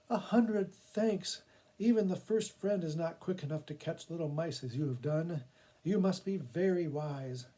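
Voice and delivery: a grateful woman praising the cat; relieved woman. Acted.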